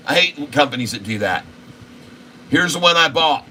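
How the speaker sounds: ordinary talk, nothing marked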